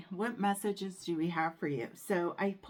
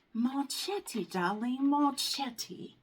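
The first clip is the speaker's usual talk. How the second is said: Marchetti sung out high in a mock Italian flair, darling rising; mock fancy accent; 7 semitones higher, slower than usual. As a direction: play a glamorous foreign diva saying Marchetti. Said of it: "I wish I had an accent".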